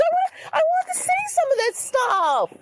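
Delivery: crying. Acted. Character crying to keep papers.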